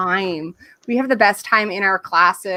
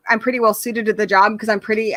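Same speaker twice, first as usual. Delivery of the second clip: normal talking voice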